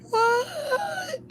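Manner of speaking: very high, long mock squeal; hammed-up astonishment. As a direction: stretch it into a thin, high-pitched squeal. Retold reaction when friends showed up.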